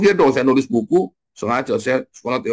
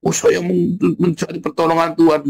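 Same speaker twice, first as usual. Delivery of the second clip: high-pitched mock plea; mock crying out; 8 semitones higher, 3 dB louder than usual. Preacher mimics someone seeking God's help, mock crying out. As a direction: imitate someone noisily begging for help. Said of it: "kamu nyanyi teriak-teriak gitu".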